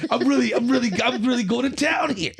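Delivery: high-pitched excited self-mockery; mock amazement. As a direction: act out being thrilled with your own progress. Mimics own excited earlier thought.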